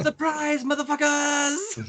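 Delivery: high, drawn-out entrance shout; Maki Ito announcing herself. Retold or imitated. Imitating a wrestler walking in triumphant, high drawn-out shout. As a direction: call it out way up high, stretching the last word. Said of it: "Maki Ito ru-, walks in".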